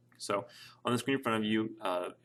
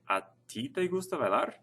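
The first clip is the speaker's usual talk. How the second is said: higher-pitched question emphasizing "ti"; stress on ti; 7 semitones higher than usual. Shown as a demonstration. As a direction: punch "ti" at the front of the question. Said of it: "really puts the emphasis on the you part".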